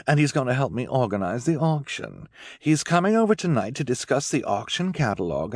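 high, imperious hostess voice; Esme. Acted. Esme sending the family out for privacy, imperious.

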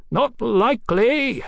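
high, drawn-out jeer; jeering refusal. Acted. Captain refuses to show himself, jeering. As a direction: call back a mocking refusal.

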